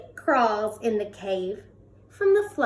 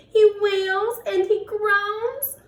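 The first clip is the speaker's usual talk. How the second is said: plain reading voice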